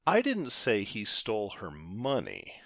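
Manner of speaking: "money" given the weight at the end; stress on MONEY. Shown as a demonstration. Teacher trying something different with the sentence, stress on "money". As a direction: save the punch for "money". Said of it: "placing the emphasis on a different word".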